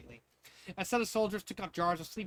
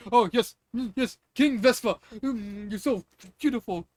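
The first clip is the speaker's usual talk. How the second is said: louder, higher, hammed-up swooning; Spaceballs parody; 5 semitones higher, 9 dB louder, slower than usual. Toy villain admiring himself in a Spaceballs spoof, hammed-up. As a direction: play it as camp villain. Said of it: "a Spaceballs scene".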